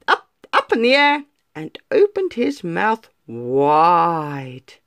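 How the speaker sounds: ordinary delivery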